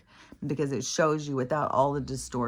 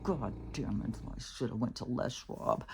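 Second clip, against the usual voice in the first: much softer, whispered angry mutter; a hint of muttering curses while leaving the shop; fuming mutter; 2 semitones lower, 9 dB quieter than usual. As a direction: grumble it to yourself under your breath.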